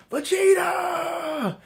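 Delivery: long, high crying shout of a name; anguished yell. Acted. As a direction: scream his name as he falls, voice breaking. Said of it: "Goku's yelling".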